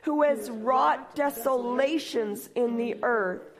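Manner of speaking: slow, measured reading of a psalm; solemn scripture reading. Acted. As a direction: read it out slowly like holy scripture.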